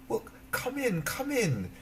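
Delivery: put-on sweet young girl ushering a guest in. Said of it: "she invited the beggar woman in".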